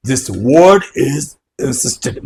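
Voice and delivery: "this word is insisted" drawn out very slowly; emphatic slow. Shown as a demonstration. Teacher stressing a key word for the class. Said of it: "very, very important".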